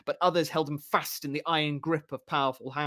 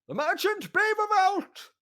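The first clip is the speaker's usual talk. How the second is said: high, slow crowing call; crowing announcement; 4 dB louder, slower than usual. Acted. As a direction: crow the name from the doorway, much higher.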